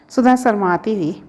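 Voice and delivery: usual speaking voice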